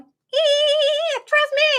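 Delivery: very high squeaky dolphin chirp. Pretend. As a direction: squeak it out like a friendly little dolphin. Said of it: "That, that's my dolphin voice".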